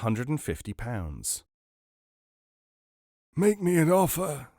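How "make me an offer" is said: high, loud wheezy rasp; wheezing old man. A narrator's reading. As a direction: wheeze it like an old asthmatic trader. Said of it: "wheezed the aging asthmatic".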